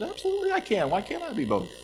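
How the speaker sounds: high, indignant disbelief; incredulous retort. Retold or imitated. Preacher answering critics, high and incredulous. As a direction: fire back at the doubter with a high, incredulous pitch.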